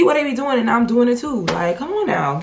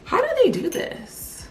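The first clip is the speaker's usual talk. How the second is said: incredulous; 2 semitones higher than usual. Real, not acted. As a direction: pitch up in baffled disbelief. Baffled rant about influencers.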